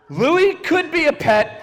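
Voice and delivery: normal talking voice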